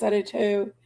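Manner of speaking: usual speaking voice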